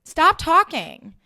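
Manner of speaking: high, slow, exasperated order; worked up. Real, not acted. Podcaster scolding the red carpet hosts, worked up. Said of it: "I know I'm getting worked up".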